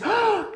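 high, sharp gasp of wonder; hammed-up storyteller gasp; amazed gasp. Shown as a demonstration. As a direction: gasp as if astonished for a child. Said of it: "Y dices".

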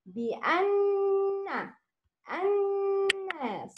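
high, slow nasal hum held for two beats; held nasal ghunnah. Shown as a demonstration. Teacher demonstrates a two-beat غنة for a student. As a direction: hum the نون through the nose for two beats. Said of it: "Make غنة with two beats".

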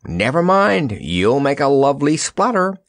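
high, lilting tease; lightly mocking singsong; teasing. A narrator's reading. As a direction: needle a friend playfully. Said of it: "teased the Scarecrow".

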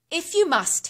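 high, loud, grudging hiss; hissed reluctance. A narrator's reading. Old woman lets Hannah in, hissing reluctantly. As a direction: spit out a grudging permission. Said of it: "hissed the woman".

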